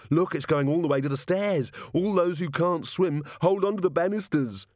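put-on high-pitched child calling out; excited child. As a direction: shout a gleeful warning to everyone. Child excitedly calls out the spreading puddle.

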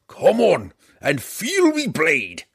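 high, loud and slow war cry; dwarf daring the drow to fight; battle challenge. Acted. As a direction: shout it while banging the shield.

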